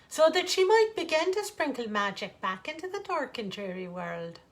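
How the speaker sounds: ordinary delivery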